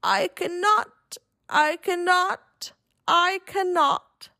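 laboured engine rhythm, drawn-out and weary; weary chugging. A narrator's reading. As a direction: chug the words out slowly, worn out. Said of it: "off he rumbled to the roundhouse, chugging".

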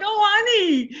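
high, loud swooning coo; swooning thrilled wife; delighted oh honey. Retold or imitated. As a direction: melt with delight at him. Wife delighted after laundry help.

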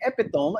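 "epitome" said as EP-i-tome; reading mispronunciation. Shown as a demonstration. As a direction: end "epitome" like "tome", with a silent final e. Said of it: "I didn't know how to say it".

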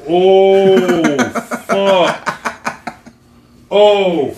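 loud, high shocked groan; real disbelief. Real, not acted. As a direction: recoil at the joke. Reacting to dark joke.

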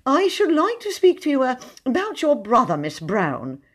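high, hesitant, simpering suitor; fawning poet. Acted. Poet courting Ethel in a high, halting, simpering voice. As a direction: woo her with nervous, halting politeness.

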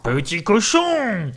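high, drawn-out wolf call. Acted. Wolf summoning the pig, high stretched call. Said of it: "le loup arriva en criant".

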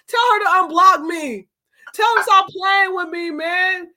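pleading ex. Retold or imitated. Voicing ex-lovers.

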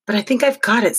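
words squeezed through teeth; through gritted teeth. A narrator's reading. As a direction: talk with jaw clenched. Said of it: "trying to talk through his teeth".

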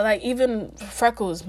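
normal talking voice

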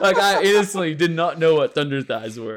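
talking through loud laughter, pitched high; laughing. Real, not acted. Telling friends about an embarrassing mistake, laughing.

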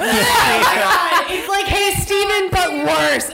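high, laughing exclamations; real laughing outburst. Real, not acted. Co-host reacting to a song's opening, laughing hard. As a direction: cry out through laughter, stretching the words.

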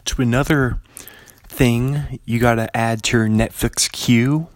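ordinary delivery

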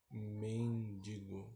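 MENDIGO stretched slowly, low and soft; drawn-out word. Shown as a demonstration. Podcaster testing a doubtful word, drawn out.

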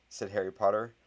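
ordinary delivery, nothing marked

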